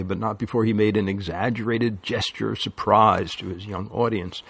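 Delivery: ordinary delivery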